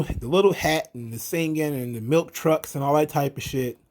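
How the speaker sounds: normal talking voice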